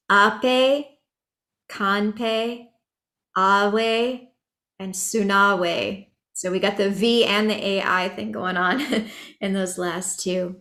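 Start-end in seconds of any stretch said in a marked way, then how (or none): 0.1-6.1 "ape, kanpe, awe, and sunawe" drawn out, each ending in an "ay" sound; pronunciation demo. Shown as a demonstration. Teacher models verse endings for chanters, "ape, kanpe, awe, and sunawe" slowly with "ay" endings. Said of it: "those last words are pronounced".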